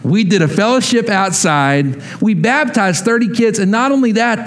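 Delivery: usual speaking voice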